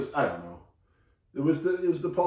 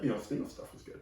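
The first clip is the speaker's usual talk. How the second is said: whispered aside; 2 semitones lower, 13 dB quieter than usual. Pretend.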